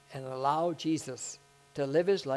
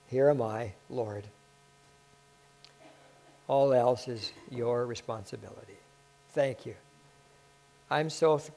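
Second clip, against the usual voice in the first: closing prayer. Acted.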